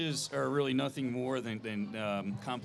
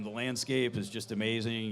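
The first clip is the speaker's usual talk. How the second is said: ordinary talk, nothing marked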